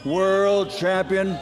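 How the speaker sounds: big booming ring announcer voice, swelling to the titles. Acted. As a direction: build each title to a roaring peak. Boxing ring introductions over music.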